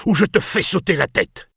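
high-pitched angry threat; furious threat. A narrator's reading. Lord threatens the builder. (menace furieuse, voix aiguë)

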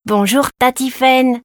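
put-on delighted child. A narrator's reading. Boy greeting a woman he likes, delighted. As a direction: sing out hello with a big smile. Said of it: "lui répondit l'enfant avec un lumineux sourire".

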